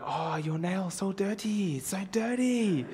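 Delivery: high, soft, slow imitation of the nail lady; mock nail salon lady. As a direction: coo it up high and quiet, dragging out "dirty". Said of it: "she's like".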